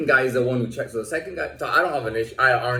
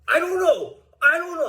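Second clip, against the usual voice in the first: very high, sheepish mock voice; imitating the cornered guard's feeble answer; mock meek guard. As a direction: squeak it out as the guard backs down. Guard backing down, mimicked.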